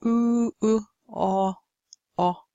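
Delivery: sustained long vowel, then its short twin; isolated back vowels. Shown as a demonstration. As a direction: demonstrate each back vowel alone, held then cut. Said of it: "Sound is produced in the back".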